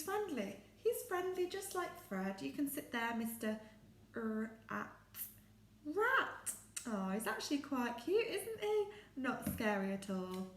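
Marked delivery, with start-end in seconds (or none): 4.2-6.5 R-, a, rat split into separate sounds then blended; segmented R-, a, rat for children; sounded out word. Shown as a demonstration. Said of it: "segmenting rat".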